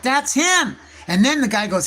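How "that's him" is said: high, loud burst of recognition; excited realisation. Retold or imitated. Recognising the author at the drum circle, high thrilled squeal. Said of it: "I'm like".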